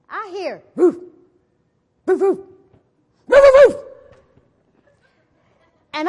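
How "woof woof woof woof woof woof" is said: barks that climb higher and louder, ending in a full loud yelp; dog barking. Retold or imitated. The chasing dog barking as she tells the story, loud barks.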